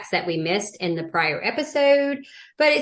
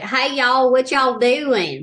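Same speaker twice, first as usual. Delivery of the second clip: high, drawn-out mock Southern twang; twangy Southern accent; 5 semitones higher, slower than usual. Shown as a demonstration. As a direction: exaggerate the Southern drawl, pitched up. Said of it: "I'm not as twangy. ... A lot of people are very like".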